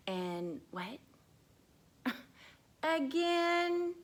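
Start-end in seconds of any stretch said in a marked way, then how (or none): 2.8-3.9 high excited puppet. Acted. Puppet eager to read again, high and excited. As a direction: squeak it out eagerly.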